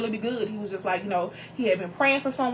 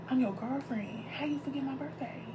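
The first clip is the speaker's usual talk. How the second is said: much softer wounded question; soft, stunned girlfriend asking how he forgot; hurt and quiet; 3 semitones higher, 12 dB quieter than usual. Retold or imitated. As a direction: say it hushed, stung. Hurt quote to boyfriend.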